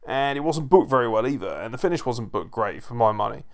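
ordinary talk

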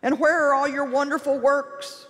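a timid man pleading and grumbling at God; wimpy whiny Gideon. Retold or imitated. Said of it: "that really wimpy, whiny voice".

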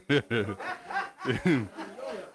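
amused chuckle; drawn-out chuckle to himself; laugh. Real, not acted. Teacher amused by Moses prophesying, laughing. Amused at his own remark.

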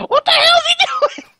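high shouted panic; alarmed shout. Real, not acted. Friends shout in panic during the fight. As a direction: yell it in alarm.

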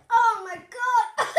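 high, loud, slow laughing. Real, not acted. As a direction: burst out laughing in disbelief. Brother crunches sour candy.

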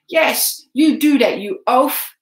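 loud, mocking shout. A narrator's reading. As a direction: yell scorn after him. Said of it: "shouted Hubert".

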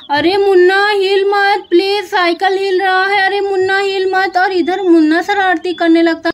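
rising alarm, ending in a scream; panicky cry. Acted. Sister panicking as her brother rocks the cycle, high cries.

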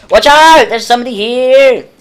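yelling a warning to a teammate; loud urgent shout. Real, not acted. Warning teammate mid firefight.

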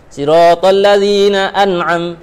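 slow, with clear n in 'an'am'; correct tajwid. Shown as a demonstration. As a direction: recite 'an'am' slowly with izhar. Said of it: "Tajwid nak baca izhar".

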